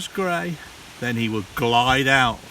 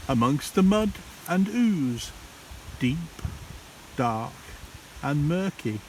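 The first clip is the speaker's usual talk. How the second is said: ordinary delivery, nothing marked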